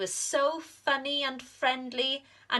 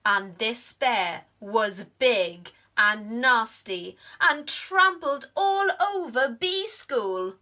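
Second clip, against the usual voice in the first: louder, higher excited storytelling; dramatic narration; 7 semitones higher, 6 dB louder than usual. A narrator's reading. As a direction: build the chaos.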